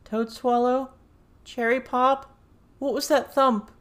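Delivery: high, loud frightened calls; scared calling. A narrator's reading. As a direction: call for friends, growing scared. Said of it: "Avery sounded so scared".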